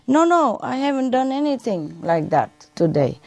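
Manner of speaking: put-on high kid voice denying everything; Johnny protesting. Johnny denies to his mother in a high kid voice. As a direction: squeak the no, no up high like a caught boy.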